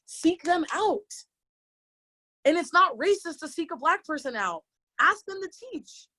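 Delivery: high-pitched, insistent and exasperated; exasperated urging. Real, not acted. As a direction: push back hard on the excuses. Urging studios after retelling their excuses.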